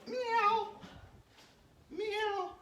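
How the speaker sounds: very high-pitched kitten meow; kitty cat meow. Pretend. Coach acting like a kitty cat, high meow. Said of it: "act like a kitty cat".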